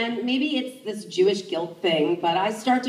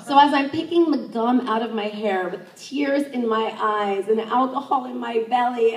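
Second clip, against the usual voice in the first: ordinary talk, nothing marked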